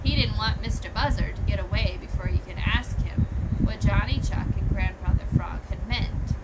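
ordinary delivery, nothing marked

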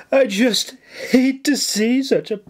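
high, breathy mock anguish; breathless faked distress. A narrator's reading. Nora faking breathless grief for the governor. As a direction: ham up the sorrow. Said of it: "Nora gasped breathlessly".

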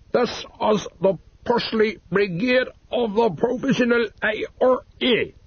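menacing fake Irish phone caller; Irish accent. A narrator's reading. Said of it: "in a supposedly Irish voice".

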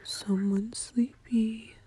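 usual speaking voice

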